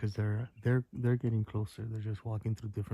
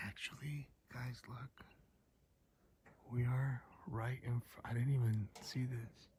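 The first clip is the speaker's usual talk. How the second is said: hushed; 3 semitones higher, 8 dB quieter than usual. Real, not acted. Spotting something at night.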